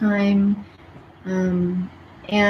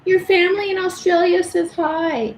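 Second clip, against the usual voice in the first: doting on pet; 9 semitones higher than usual. Real, not acted. Talking to the dog again.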